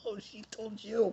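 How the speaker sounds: high, slow, laughing gloat; gloating to himself that April told off the boss, laughing; gleeful laughing. Retold or imitated.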